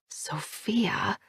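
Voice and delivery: unvoiced disbelieving whisper; soft bewildered whisper; whispered to herself. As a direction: breathe it in disbelief. Inner thought, unvoiced.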